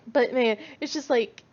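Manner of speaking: usual speaking voice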